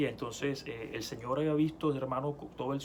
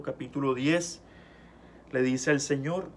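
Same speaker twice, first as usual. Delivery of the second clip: ordinary delivery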